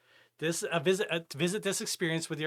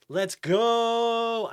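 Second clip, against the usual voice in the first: high, loud, drawn-out cheer; hyped cheer; 7 semitones higher, 7 dB louder than usual. Real, not acted. Streamer with Robux to spend, hyped. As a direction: stretch it out in a loud whoop.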